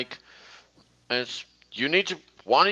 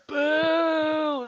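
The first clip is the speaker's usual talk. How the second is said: much higher playful boo; mock booing; 11 semitones higher than usual. Child booing Thomas, a much higher playful boo. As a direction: boo like a pantomime crowd.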